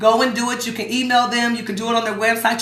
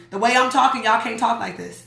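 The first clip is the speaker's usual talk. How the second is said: usual speaking voice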